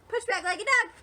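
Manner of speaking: excited rapid-fire instruction; quick and lively. Shown as a demonstration. Repeats the routine faster, livelier.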